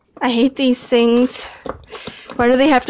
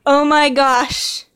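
usual speaking voice

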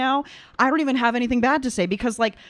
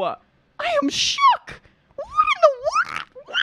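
much higher, squealing disbelief; stunned squeal. Real, not acted. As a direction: pitch right up in shocked disbelief. Reacting stunned to opera singing.

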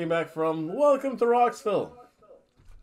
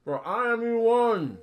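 grand proclamation; 8 semitones higher, 4 dB louder than usual. Pretend. Grand self-introduction, mock heroic.

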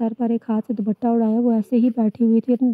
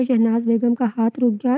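ordinary delivery

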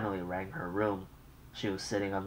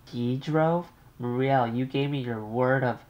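higher, louder shocked question; mother can't believe it; alarmed mother; 5 semitones higher, 6 dB louder than usual. Acted. Mother alarmed her son-in-law drove, raised and shocked.

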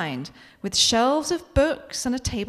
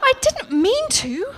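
higher, slow defensive whine; 13 semitones higher, slower than usual. Acted. Becky caught eating the prop cake, defensive. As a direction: protest your innocence. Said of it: "I say defensively".

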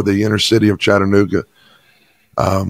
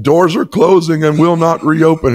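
much higher and louder, bursting out the words; excited declaration; 7 semitones higher, 3 dB louder than usual. Retold or imitated. Pastor telling his stunned assistant the message, excited and emphatic. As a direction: proclaim it like a revelation just received.

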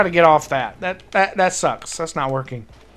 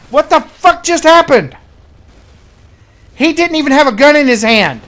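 high, loud indignant shout; outraged yell; 8 semitones higher, 6 dB louder than usual. Real, not acted. Gamer killed by unarmed enemy, outraged yell. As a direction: yell it in disbelief.

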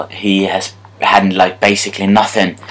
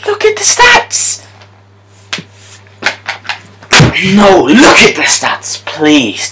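high, slow outraged shouts; protesting the unfair loss to EA; furious slow disbelief; 10 semitones higher than usual. Real, not acted. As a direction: drag out every word in outrage.

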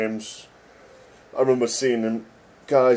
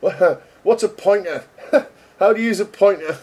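laughing through the question, higher and louder; 11 semitones higher, 6 dB louder than usual. Retold or imitated. A newbie coder asking about pointers, laughing. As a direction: giggle out the question at yourself.

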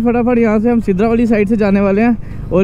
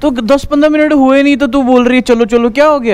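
higher, pretend-exasperated nagging; mock angry complaint; 6 semitones higher than usual. Brother complains to sister as part of an angry prank. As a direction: act annoyed with her. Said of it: "ये था हमारा angry prank".

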